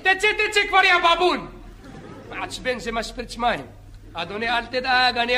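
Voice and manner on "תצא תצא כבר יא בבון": lifeguard yelling. Acted.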